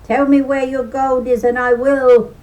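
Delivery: loud, big-man boom; booming demand. Acted. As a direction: boom it down at the little fellow. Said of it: "boomed Niall".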